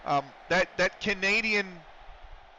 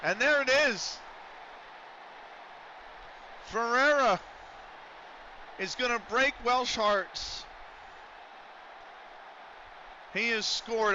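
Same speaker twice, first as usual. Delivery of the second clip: excited goal call; 7 semitones higher than usual. Real, not acted. Goal scored in commentated match.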